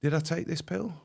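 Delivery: normal talking voice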